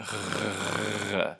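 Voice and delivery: buzzing throaty R with voice; gargle-like voiced uvular rasp; voiced uvular fricative. Shown as a demonstration. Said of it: "like that when I make it".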